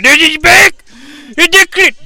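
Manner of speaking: high, loud yelps; excited. Acted. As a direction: shriek at the screen. Excited at the screen.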